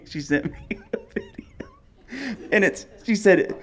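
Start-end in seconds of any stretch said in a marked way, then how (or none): 0.0-1.3 wheezing, voiceless laugh through the words; helpless laughter. Real, not acted. Cracking up while retelling.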